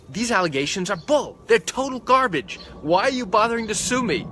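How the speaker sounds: high, loud scoffing outrage; outraged doctor. Retold or imitated. Doctor and his attorney mocking the lawsuit, outraged and loud. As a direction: scoff at the claims as total garbage. Doctor voiced dismissing allegations.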